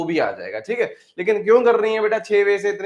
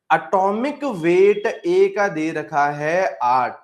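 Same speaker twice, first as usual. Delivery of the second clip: dictating slowly; 3 dB louder, much slower than usual. Shown as a demonstration. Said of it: "repeats slowly for dictation".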